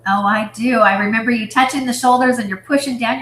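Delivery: usual speaking voice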